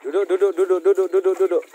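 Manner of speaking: loud repeated command to the animals. Real, not acted. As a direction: bark the order to sit. Ordering animals to sit. (perintah keras berulang kepada hewan)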